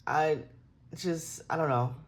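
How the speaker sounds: ordinary delivery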